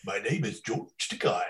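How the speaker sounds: lightly imitated grand stage voice; a measured, theatrical introduction; hint of a thespian's delivery; theatrical George Takei. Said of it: "His voice is just, like, perfect theatrical voice".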